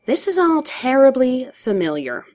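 high, weary slide downward; sighing. A narrator's reading. Joker complains to Batman, sighing. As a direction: sigh the words out theatrically. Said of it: "The Joker sighed".

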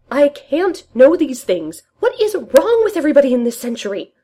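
protest, mortified and overwhelmed; flustered outburst. Acted. Rogers flustered, covering ears.

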